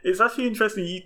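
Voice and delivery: ordinary delivery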